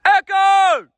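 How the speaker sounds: very high, full-force shout; screamed echo call. Real, not acted. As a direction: yell out to make it echo. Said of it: "whatever the fuck I was screaming".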